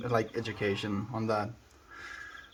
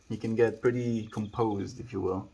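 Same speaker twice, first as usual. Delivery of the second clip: ordinary talk, nothing marked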